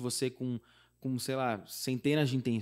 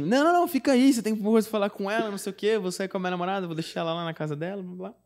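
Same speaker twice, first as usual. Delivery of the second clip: insistent friend; 7 semitones higher, faster than usual. Retold or imitated. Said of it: "meu amigo falou assim".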